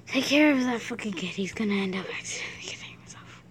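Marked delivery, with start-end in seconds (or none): 1.3-3.4 hushed unvoiced aside; whisper. Real, not acted. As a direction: whisper it behind his back. Aside gossip while Matt is absent.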